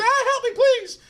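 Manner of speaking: very high-pitched cry for help; screaming like crazy. Retold or imitated. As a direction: scream it like a woman, far above your voice. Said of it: "I'm screaming like crazy".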